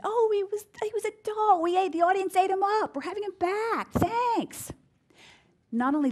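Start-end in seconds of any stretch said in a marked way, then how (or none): 0.0-4.7 much higher and louder, imitating a delighted producer; gushing producer. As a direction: play the producer raving about the doctor on the phone. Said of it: "And they said".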